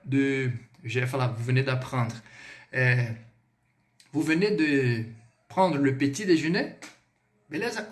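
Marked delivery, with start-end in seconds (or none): 5.5-6.8 "prendre le petit déjeuner" rising at the end; "déjeuner" tilted upward as a question; question intonation. Shown as a demonstration. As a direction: ask it by lifting the pitch on "déjeuner". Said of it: "Olha a entonação. Pergunta".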